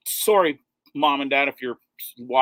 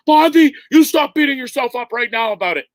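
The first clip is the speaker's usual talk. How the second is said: imitating friend; 7 semitones higher, 4 dB louder than usual. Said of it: "and you're like".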